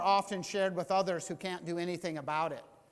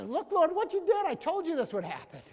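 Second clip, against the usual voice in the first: higher and louder accusing; mock complaint to God; 11 semitones higher, 8 dB louder than usual. A believer complaining to the Lord like Jonah, higher and louder. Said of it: "It's like".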